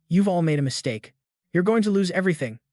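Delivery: ordinary delivery, nothing marked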